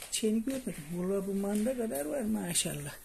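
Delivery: normal talking voice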